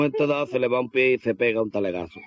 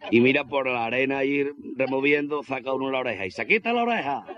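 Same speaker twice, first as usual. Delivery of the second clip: normal talking voice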